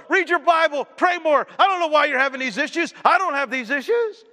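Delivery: mock preachy scold; smug judgmental voice. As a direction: sneer it like a self-righteous churchgoer.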